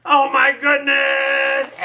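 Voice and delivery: loud, high, stretched-out shock; shocked exclamation. Acted. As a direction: cry out slowly at the crash. Reacting to the big crash.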